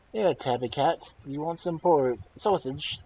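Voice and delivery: put-on high, loud child troll call; troll child shouting. Troll child shouting at the bear. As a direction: yell it like a bratty little troll. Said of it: "troll child shouted".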